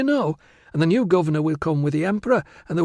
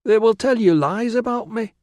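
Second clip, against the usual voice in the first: put-on high, urgent girlish objection; anxious protest; 11 semitones higher, 4 dB louder than usual. Virana warns Tilla her family will lie, anxious. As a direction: blurt it out defensively, pitched up.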